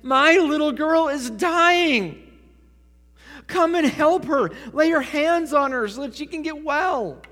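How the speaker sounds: loud, high-pitched plea in full preaching voice; desperate begging. Acted. Father begging Jesus for his dying daughter, desperate pleading. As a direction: cry out, begging for urgent help. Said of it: "the text says that he begged him".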